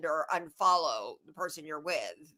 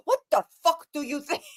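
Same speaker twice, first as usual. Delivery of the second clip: put-on Rennell's accent; 7 semitones higher, 6 dB louder than usual. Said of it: "she'll just say".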